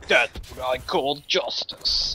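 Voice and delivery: low, slow mock-heroic pronouncement; mock-solemn onlooker. Mimics people at the computers.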